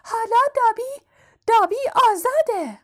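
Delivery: high-pitched elf squeal of freedom; excited house-elf. A narrator's reading. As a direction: proclaim it in a high little elf voice. Voices house-elf freed by master.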